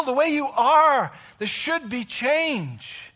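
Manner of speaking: loud, high, slow preaching; preaching at full force. Acted. Preacher urging the congregation, loud and slow. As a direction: drive the point home from the pulpit.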